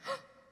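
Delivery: sharp high gasp; a sudden quiet gasp of shock; startled intake of breath. A narrator's reading. Fox shocked by the terms.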